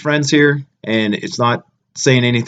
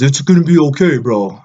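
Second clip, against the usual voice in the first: mocking bro; 2 semitones higher, 3 dB louder than usual. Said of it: "And he goes".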